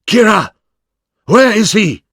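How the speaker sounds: high urgent call across the house. A narrator's reading. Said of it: "Oishi called out to Yoshida".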